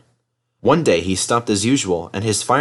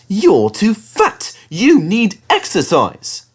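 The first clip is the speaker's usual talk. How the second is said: higher, slow, louder telling-off; scolding engine; 10 semitones higher, 4 dB louder, much slower than usual. Acted. Thomas tells Henry off about his weight. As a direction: lecture him slowly.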